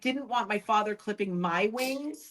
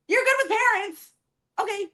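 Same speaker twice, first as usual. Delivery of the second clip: incredulous; 12 semitones higher than usual. Real, not acted. Daughter reacting to creepy billionaire's claim, high incredulous echo. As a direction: echo it back in total disbelief.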